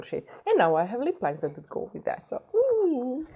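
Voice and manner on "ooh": high, delighted "ooh"; excited squeal. Real, not acted. Thrilled at lip liners.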